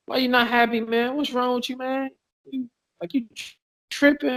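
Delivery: puzzled Thai locals. Retold or imitated. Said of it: "they look at you like that".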